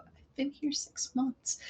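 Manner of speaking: muttered under her breath; quiet. Acted. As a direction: murmur it as an aside. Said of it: "quietly as you're walking by".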